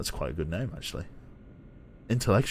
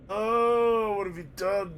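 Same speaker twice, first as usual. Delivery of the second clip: high, yawn-stretched lament; words swallowed by a helpless yawn; talking through a yawn; 10 semitones higher, much slower than usual. Real, not acted. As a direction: wail it mid-yawn. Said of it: "Now I'm yawning as well".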